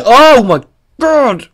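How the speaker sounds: sneeze, then groan it out high. Real, not acted. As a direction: sneeze then slow exasperated cry. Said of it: "I need to stop with the sneezing".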